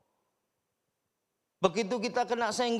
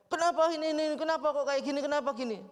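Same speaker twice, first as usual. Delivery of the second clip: high, fast, rattled questions; flustered fretting; 6 semitones higher, faster than usual. Retold or imitated. Someone stewing over gossip, high fast flustered questions. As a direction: fuss in a mock panic. Flustered reaction to bad news.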